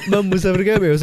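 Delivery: ordinary talk, nothing marked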